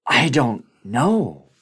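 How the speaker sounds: loud, slow and stretched, a voice not his own; exhausted colleague says he doesn't know; Faraj's helpless answer. Retold or imitated. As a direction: blurt it out loud and slow, at a loss.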